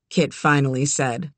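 usual speaking voice

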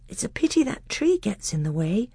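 put-on a young prince speaking eagerly. A narrator's reading.

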